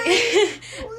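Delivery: loud high burst of laughter. Real, not acted. Laughing at dark positive line.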